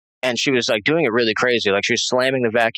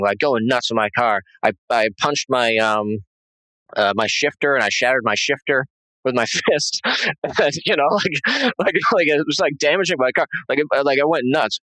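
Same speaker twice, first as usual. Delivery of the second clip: normal talking voice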